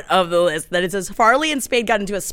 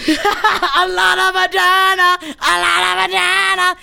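playful echo; 7 semitones higher than usual. Acted. Echoing a joke, laughing.

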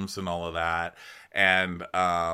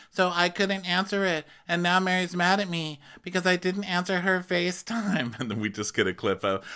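ordinary talk

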